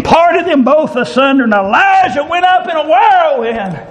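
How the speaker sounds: high, shouted joyful preaching with whoops; preaching at full force. Acted. Preacher celebrating the chariot of fire, shouted preaching with whoops. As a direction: lift the church up with it.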